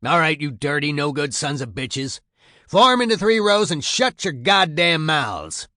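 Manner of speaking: sergeant's shouted order, rising in force; berating tirade. A narrator's reading. Sergeant orders new recruits into rows, loud angry berating. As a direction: berate them, building to a loud, high command. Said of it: "such an awful tirade".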